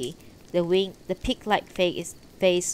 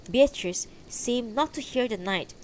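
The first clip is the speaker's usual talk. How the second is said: usual speaking voice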